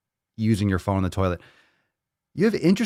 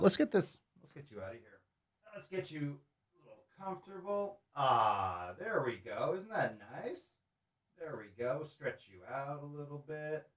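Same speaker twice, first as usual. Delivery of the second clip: hushed, cooing words to a doll; soft coaxing; 4 semitones higher, 12 dB quieter than usual. Real, not acted. Gently soothing the doll while freeing her from the nut, hushed. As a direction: coo softly while fixing her up. Fussing over a doll's hair.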